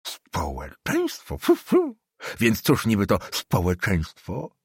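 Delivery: put-on old councillor scoffing at the student, slow and scornful; scoffing old man. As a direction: pour scorn on the word.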